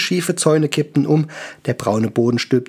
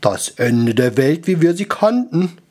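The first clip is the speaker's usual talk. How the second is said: lightly voiced brooding wizard; murmuring wizard; 4 semitones higher than usual. A narrator's reading. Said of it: "murmelte Gaston".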